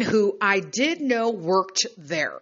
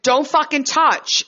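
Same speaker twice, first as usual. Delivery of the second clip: angry warning; 7 semitones higher, 4 dB louder, slower than usual. Retold or imitated. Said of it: "but I was like".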